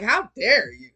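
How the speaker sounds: mock outrage. Mocking offended unhealed people.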